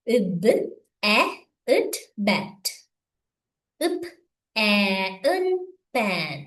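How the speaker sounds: sounds apart, as in "இப், எ, இட்", then whole "பெட்"; isolated sounds with gaps, then the joined word like "மக்"; phonics blending. Shown as a demonstration. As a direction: spell out "இர், அ, இன்" by sound, then blend "ரன்". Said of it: "இதுல என்ன செய்யணும் பாருங்க".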